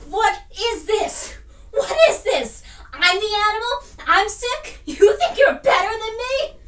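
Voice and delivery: indignant confrontation. Acted. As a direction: throw the accusations back at them. Acted quarrel, indignant confrontation.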